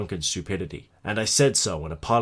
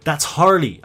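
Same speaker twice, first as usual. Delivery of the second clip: high, loud cry of alarm; frantic shout; 7 semitones higher, 8 dB louder than usual. A narrator's reading. Guest hears friend screaming outside, shouts in alarm. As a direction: shout it out desperately. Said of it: "I shouted".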